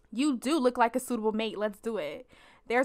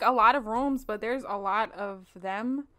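normal talking voice

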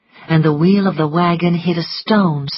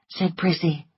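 usual speaking voice

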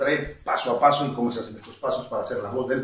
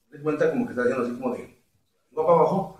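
ordinary talk, nothing marked

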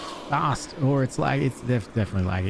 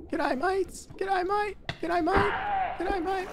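high squeaky Australian g'day; high, hammed-up Australian greeting; mock Australian; 5 dB quieter, slower than usual. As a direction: chirp it like a cartoon Aussie. Playful Aussie greeting voice.

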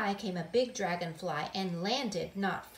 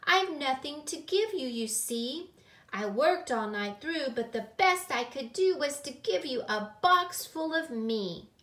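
lightly voiced child confessing; sheepish little girl; 5 semitones higher, 4 dB louder than usual. A narrator's reading. Gilly offering herself as the gift, lightly sheepish child voice. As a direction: start high, confess it earnestly. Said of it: "feeling quite silly".

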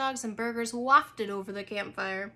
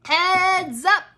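loud warning call, higher; 7 semitones higher, 10 dB louder than usual. A narrator's reading. Boy calls out to warn of the frisbee, loud. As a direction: shout a warning across the camp. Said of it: "came a call from behind me".

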